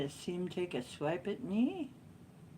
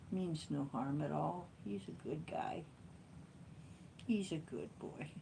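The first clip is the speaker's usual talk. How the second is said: ordinary talk, nothing marked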